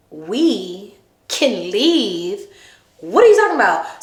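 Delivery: slow, drawn-out disbelief; incredulous. Real, not acted. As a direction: repeat it, stunned. Repeating his words in disbelief.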